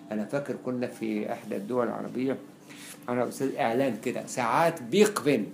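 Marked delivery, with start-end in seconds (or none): 4.8-5.5 "بيقبن" said with a q, for Big Ben; slow reading of "بيقبن" with a qaf sound; qaf spelling pronounced. Shown as a demonstration. Teacher quoting a watch advert, "بيقبن" read with q. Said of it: "فدي بالقاف".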